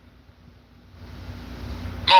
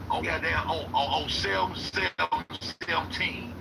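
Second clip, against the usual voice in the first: much softer, secretive storytelling; hushed conspiracy voice; 12 dB quieter than usual. Acted. Telling viewers the [name] kidney theory, hushed and secretive. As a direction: lean in and tell it like a secret.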